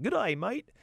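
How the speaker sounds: high-pitched attempt at an Australian accent. Shown as a demonstration. Host trying out an Australian greeting, hammed-up Australian accent. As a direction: put on a brief, jokey Australian accent. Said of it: "What's an Australian accent?".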